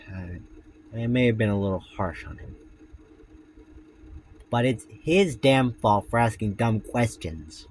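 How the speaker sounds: low, soft story reading; story narration. A narrator's reading. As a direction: read the tale in a hushed voice. Reads Foxy story aloud.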